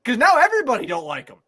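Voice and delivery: high-pitched, worked-up ranting; incredulous, high-pitched fan outrage; exasperated rant. Real, not acted. Worked-up rant about the team's leader.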